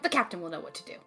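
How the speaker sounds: ordinary talk, nothing marked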